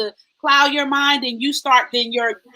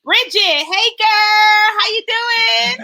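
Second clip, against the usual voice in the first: high, loud, drawn-out hello; gushing singsong hey; excited greeting; 8 semitones higher, 4 dB louder, slower than usual. Real, not acted. Greeting a friend in the comments.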